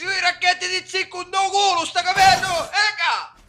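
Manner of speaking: loud, rising fury; angry yelling. Acted. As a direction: yell while hitting him. Beating the instructor, yelling.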